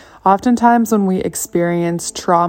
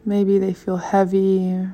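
unhurried meditation voice with long pauses; calm body-scan guidance, softer than chatting; guided meditation; 6 dB quieter than usual. Acted.

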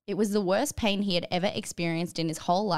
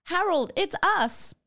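high desperate yell; mock desperate yell; 10 semitones higher, slower than usual. A narrator's reading. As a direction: call out to him, pleading. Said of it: "yelled Michael".

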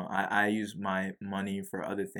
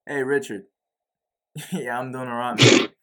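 mock-casual phone chat in a put-on Australian accent; 4 semitones higher, 8 dB louder than usual. On a pretend call to Richard Branson, Australian accent. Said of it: "I'm copying your Australian accent".

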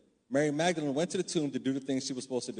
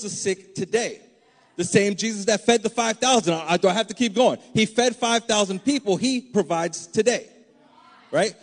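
louder, higher, building fervent preaching; preaching at full force; 4 semitones higher, 6 dB louder than usual. Acted. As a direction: drive it home to the church. Preaching climax to congregation.

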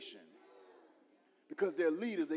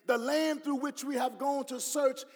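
preached scripture; 8 semitones higher, 7 dB louder than usual. Acted. Scripture read with force.

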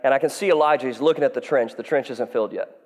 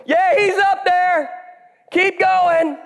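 high, slow, louder jeer; mocking taunt; 4 dB louder, much slower than usual. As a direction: egg them on mockingly. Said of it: "he's so taunting them".

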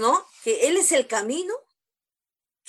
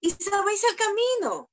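voicing Jesus; 6 semitones higher than usual. Preacher voicing Jesus' question.

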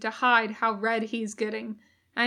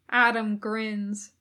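plain reading voice

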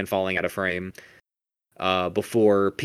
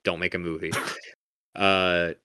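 ordinary delivery

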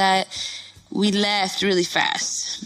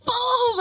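slap sound effect, pitched way up; high "boom" impact. Retold or imitated. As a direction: punch out a drawn impact noise. Imitates the slap landing.

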